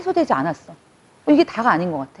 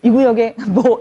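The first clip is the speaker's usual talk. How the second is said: smug insider mock; 3 dB louder, slower than usual. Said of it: "이러면서".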